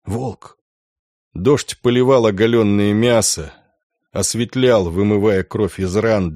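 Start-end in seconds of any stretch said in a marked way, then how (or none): 0.0-0.6 soft, hushed single word; much softer, near-whispered realisation; whispering. A narrator's reading. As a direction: let it drop out under your breath. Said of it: "прошептал Ганс".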